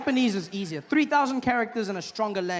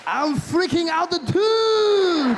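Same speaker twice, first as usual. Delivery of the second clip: put-on Japanese accent; 6 semitones higher, 5 dB louder, much slower than usual. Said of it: "English with a Japanese accent".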